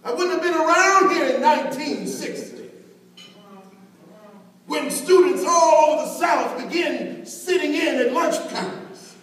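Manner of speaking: loud, rising sermon cadence; preaching at full force. Acted. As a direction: build the refrain into a ringing climax. Building "if I had sneezed" refrain.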